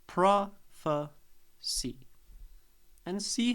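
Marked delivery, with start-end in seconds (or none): 0.1-2.1 "Prophecy" drawn out very slowly, PRO stressed, "phe" reduced to a schwa; slow stress demo. Shown as a demonstration. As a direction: stretch "Prophecy" out slowly, strong PRO, weak middle. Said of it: "unstressed on phe".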